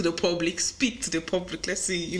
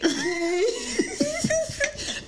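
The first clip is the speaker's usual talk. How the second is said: high-pitched drawn-out laugh. Real, not acted. Host amused by guest claiming friendship with a celebrity, high laugh.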